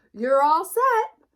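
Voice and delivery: high, loud and slow; proud announcement. Acted. Sheep hands the map to Sheepdog, proud loud announcement. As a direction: announce it with triumph. Sheep finishes equipping the dog.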